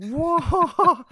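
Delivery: very high, drawn-out impressed whoa; a hammed-up stunned whoa at the put-down; mock amazed whoa. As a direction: gasp it like you can't believe it. Narrator reacting to the scolding.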